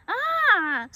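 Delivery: loud high-pitched "ah" yelp; startled yelp. A narrator's reading. Fawn splashing into cold water, a loud yelp. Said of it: "Barner yelped as he splashed".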